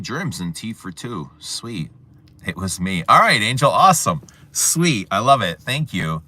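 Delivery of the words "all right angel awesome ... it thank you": loud, high and happy, cheering a viewer on; excited thanks. Real, not acted. Thanking a stream viewer for a gift, excited and loud.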